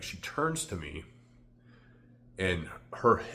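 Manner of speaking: ordinary delivery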